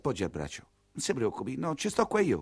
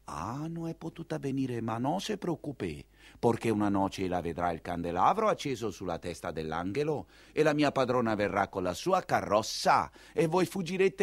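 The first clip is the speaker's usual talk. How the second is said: low, soft, consoling; calm him gently; soft reassurance; 6 semitones lower, 4 dB quieter than usual. Acted. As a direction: servant soothing the old lover.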